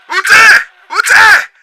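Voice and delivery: very high, very loud shrieks; screaming search. Acted. Host screaming for the hidden insect. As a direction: shriek out for the hiding bug.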